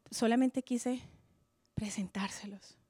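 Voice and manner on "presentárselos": trailing whisper; whispered aside. Real, not acted. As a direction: drop to a whisper to end the thought. Intimate close of thought.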